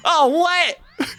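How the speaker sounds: very high, loud "Oh, what?"; a real shocked high yelp; shocked cry. Real, not acted. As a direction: cry out in disbelief. Getting eaten by monster.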